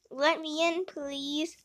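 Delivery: ordinary delivery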